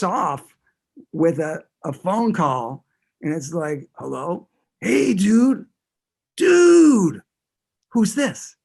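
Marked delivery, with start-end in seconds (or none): 4.0-8.6 high, loud dopey Boston dude; Boston accent. Retold or imitated. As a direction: ham up a dumb Boston metalhead. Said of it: "talking in a terrible Boston accent".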